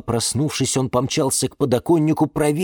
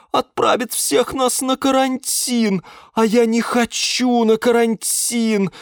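grumbling complaint; 11 semitones higher, slower than usual. Acted. Liana grumbling on.

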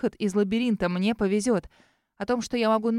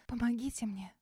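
сквозь слёзы; 10 dB quieter than usual. Acted. Испуганная мольба героини.

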